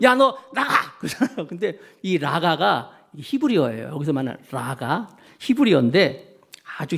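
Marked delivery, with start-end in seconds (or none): 0.0-1.0 hammed-up temper, pitched high; mock angry outburst. Preacher mimicking people losing their temper, mock angry outburst. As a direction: act out losing your temper, voice jumping up. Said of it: "우리도 승질내면".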